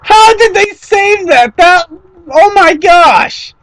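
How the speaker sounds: amazed. Real, not acted. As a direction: marvel at what just happened. Still amazed at save.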